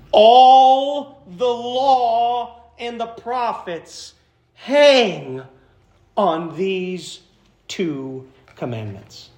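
slow, drawn-out verse, starting high and stepping down; weighty scripture reading. A narrator's reading. Reads the key verse for emphasis.

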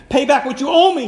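loud, high storyteller's demand; forceful demand. A narrator's reading. Servant choking his fellow servant over a debt, demanding. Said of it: "he demanded".

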